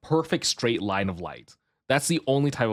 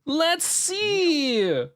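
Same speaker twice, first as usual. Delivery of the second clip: high put-on voice; much slower than usual.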